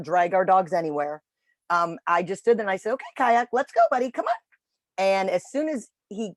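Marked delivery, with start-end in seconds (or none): 2.9-4.3 higher, encouraging dog-call voice; high-pitched coaxing. Retold or imitated. Trainer urging a stalled dog to walk, high-pitched coaxing. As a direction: coax the dog along in a raised pitch. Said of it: "used my high-pitched voice".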